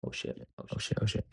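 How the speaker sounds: very low, barely voiced mutter; hushed aside. Real, not acted. Muttered aside during a mishap.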